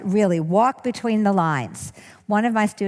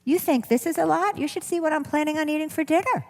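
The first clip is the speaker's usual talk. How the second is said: high, quick playful retort; humorous comeback; 9 semitones higher, faster than usual. Shown as a demonstration. Speaker demonstrating a joking answer to the critic, high and fast. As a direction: shrug the critic off with a joke.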